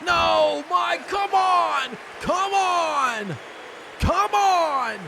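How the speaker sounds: high, drawn-out cries at the action; agitated outcry. Real, not acted. Commentator pleading at the action in the ring, agitated. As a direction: cry out in protest, pitched high.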